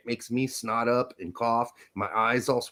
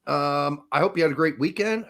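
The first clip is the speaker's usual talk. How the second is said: ordinary delivery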